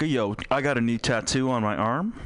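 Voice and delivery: ordinary talk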